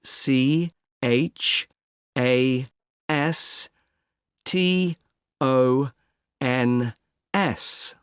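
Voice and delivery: letter by letter, with clear pauses; very slow, measured spelling for dictation. Acted. Agent spelling a street name for a caller, slowly letter by letter.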